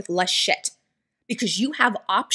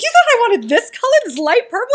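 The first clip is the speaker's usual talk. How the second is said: much higher, louder mock outrage; high indignant mock squeal; shocked disbelief; 12 semitones higher, 6 dB louder than usual. Husband indignant, high and loud.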